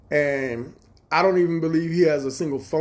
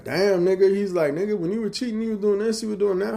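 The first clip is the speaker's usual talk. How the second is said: much higher, teasing mock voice; imitating brother-in-law; 9 semitones higher, 4 dB louder than usual. Brother-in-law teasing him about cheating, high mocking voice. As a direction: needle him playfully.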